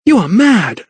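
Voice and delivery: high-pitched outraged cry; appalled, high exclamation; cried out. A narrator's reading. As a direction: fling the accusation out, pitch leaping. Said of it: "she cried".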